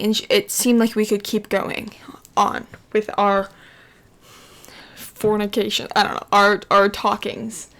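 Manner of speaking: ordinary delivery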